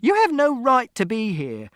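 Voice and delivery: high, loud accusation; angry shout. A narrator's reading. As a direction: shout him out of your home. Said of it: "Mother shouted".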